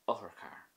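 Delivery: "Othar char" starting on a short, sharp o; don't linger on the first vowel of "Othar char"; quick sharp O. Shown as a demonstration. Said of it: "was quick and, um, relatively sharp".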